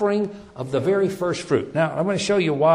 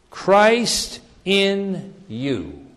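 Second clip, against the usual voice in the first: slow emphasis; 4 semitones higher, much slower than usual. Shown as a demonstration. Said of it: "which is".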